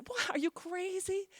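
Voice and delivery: high, slow mocking scoff; scoffing disbelief. Surly hairdresser mocking her client's Pope plans, high slow scoff. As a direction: scoff at the very idea.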